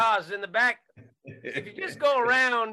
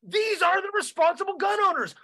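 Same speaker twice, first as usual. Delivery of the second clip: ordinary delivery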